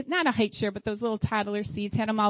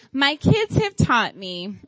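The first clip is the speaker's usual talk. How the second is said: ordinary talk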